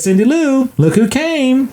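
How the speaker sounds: high, excited call across the room; delighted mom call. Retold or imitated. S0 voices Donna calling.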